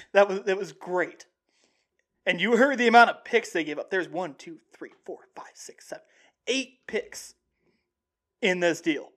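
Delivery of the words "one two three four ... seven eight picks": very soft, nearly whispered count; counting under breath. Real, not acted. Host tallying the draft picks to himself, under his breath. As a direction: count quietly to yourself, voice dropping away.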